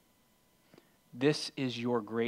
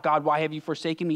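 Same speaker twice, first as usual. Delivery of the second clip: raised, quick mock cry to God; retold cry; 4 semitones higher, 7 dB louder, faster than usual. Preacher quotes Jesus calling from the cross, retold cry. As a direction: cry it out loudly, at preaching pitch. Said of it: "where he cries out".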